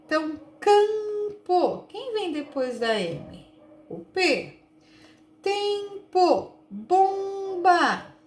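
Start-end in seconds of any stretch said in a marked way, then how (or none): 0.6-1.9 CAMPO high, nasal "cam" held, then "po"; sílabas destacadas. Shown as a demonstration. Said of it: "letras que vêm depois da letra M". 5.4-8.1 TEMPO, BOMBA high, each nasal first syllable held long; sílabas destacadas. Shown as a demonstration. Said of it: "vêm depois da letra M".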